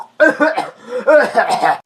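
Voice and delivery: faked cough breaking into a laugh; badly faked sick cough; fake cough with a laugh. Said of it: "nilalagnat ako".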